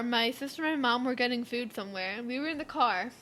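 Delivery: normal talking voice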